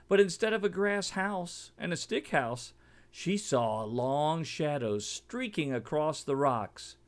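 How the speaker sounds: ordinary delivery, nothing marked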